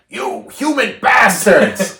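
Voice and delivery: loud, high, drawn-out shout; yelled outburst. Retold or imitated. Caesar snapping at guards abusing an ape, yelled outburst. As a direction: yell it out slowly, every word stretched. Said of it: "so he just yells out".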